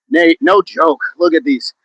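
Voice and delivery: usual speaking voice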